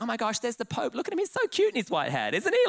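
high, gushing mum behind the camera; commentating mum. Retold or imitated. Mum filming the Pope for her video, gushing. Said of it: "she starts commentating as she films".

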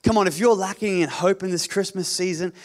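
ordinary delivery

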